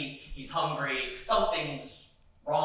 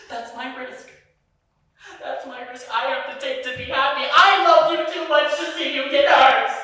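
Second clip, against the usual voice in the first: higher and louder, begging to be heard; pleading outburst; 10 semitones higher, 11 dB louder than usual. Retold or imitated. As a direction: plead with your partner out of fear. Boyfriend pleading his fear.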